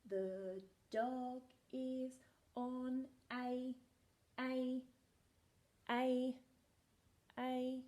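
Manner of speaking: drawn-out reading aloud; very slow reading. Shown as a demonstration. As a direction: stretch each word very slowly. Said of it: "very slow reading".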